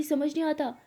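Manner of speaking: put-on a girlfriend shouting in the car; angry shout. A narrator's reading. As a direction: snap at her to let go. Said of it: "तो lily shout कर लेती है".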